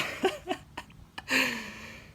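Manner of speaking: a high, breathless laugh after the yell. Real, not acted.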